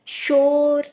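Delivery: शोर called out loud with a long ओ; loud demo word. Shown as a demonstration. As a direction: belt शोर for the class. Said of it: "ओ की आवाज़ आ रही है". (ज़ोर से शोर)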